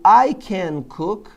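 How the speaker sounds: very slow I can cook, each word drawn out; slow demo. Shown as a demonstration. Teacher models the English phrase slowly for students. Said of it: "slow demo English".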